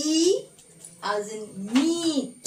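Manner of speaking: "E" and "meat" with long held ee; prolonged vowel. Shown as a demonstration. Teacher naming the first long vowel, "meat" stretched. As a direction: draw out the ee in "meat", slowly. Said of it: "in a prolonged manner".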